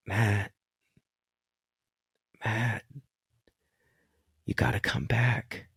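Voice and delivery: hushed, gentle calling of a name, fading to a breathy whisper; retold guide whispering his name; soft whispered call. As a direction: coax him back softly, almost whispering. Guide gently calling him back.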